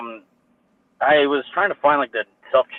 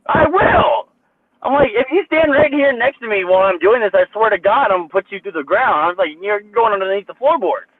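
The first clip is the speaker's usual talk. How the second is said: much higher, heated threat; retold angry threat; 6 semitones higher than usual. Retelling threatening the employee at checkout, heated. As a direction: threaten him, fed up.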